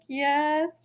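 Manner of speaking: high-pitched polite yes; retold polite answer. Vlogger answers old neighbor at door, high polite voice.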